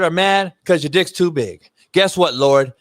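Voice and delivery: usual speaking voice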